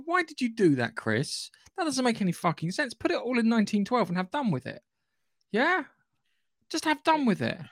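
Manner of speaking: high-pitched exasperated pleading with the writer; exasperated rant. Acted. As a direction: plead in disbelief, voice climbing high. Ranting at absent writer Chris.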